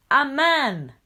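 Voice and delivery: loud, high shocked cry. A narrator's reading. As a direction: shout it out as a pack. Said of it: "cried the young wolves".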